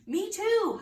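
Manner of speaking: put-on high, loud kid's holler. Boy in class yells agreement, high and loud. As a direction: shout it out like an eager boy. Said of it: "hollered a boy".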